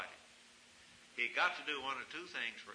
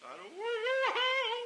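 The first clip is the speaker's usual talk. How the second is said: high, loud, whining cry; a scared boy wailing his fear; very high mock-whine; frightened young man; 9 dB louder than usual.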